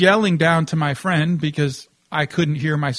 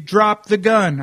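raised, higher command; mild mock yell, louder and higher; retold yell; 4 semitones higher, 6 dB louder, slower than usual. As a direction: imitate an armed man's shouted order. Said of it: "So he's yelling".

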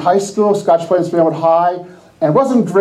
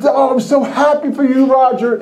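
high, put-on gushing; fake happiness; 7 semitones higher than usual. Envious friend congratulating Roger, falsely happy. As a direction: gush with forced delight. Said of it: "Inside I'm going".

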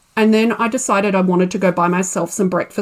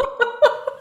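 high nervous giggle; high nervous laugh; 3 dB quieter than usual. Real, not acted. Panicked laugh.

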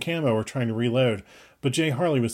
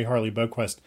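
ordinary delivery

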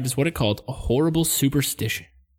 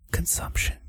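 eerie whispered word; spooky whisper; 7 dB quieter than usual. Pretend. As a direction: whisper it like a ghost story. Spooky retelling of old disease.

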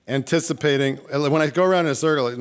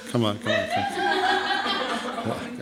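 laughing; 11 semitones higher, 4 dB quieter, much slower than usual. Real, not acted. Coaxing the crowd, laughing.

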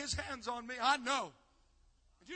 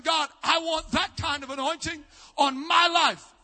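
louder, driving preaching over music; fervent altar call; 5 semitones higher, 15 dB louder than usual. Acted. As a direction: push the congregation to respond. Altar call at full force.

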